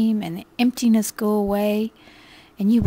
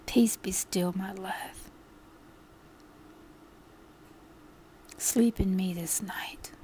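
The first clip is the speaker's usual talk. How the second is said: much softer, whispered comfort; tender Lord; 9 dB quieter than usual. Acted. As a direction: whisper a loving goodnight blessing. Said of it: "the Lord will, has said".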